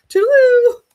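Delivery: very high, loud drawn-out goodbye; mock cheery farewell. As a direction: sing it out as you escape. Calls a mock farewell while leaving.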